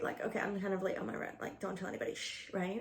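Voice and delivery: hushed playful secret; soft, conspiratorial aside; confiding. Pretend. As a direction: drop the voice as if nobody should hear.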